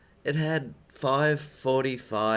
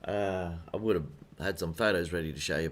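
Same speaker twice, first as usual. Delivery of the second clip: ordinary delivery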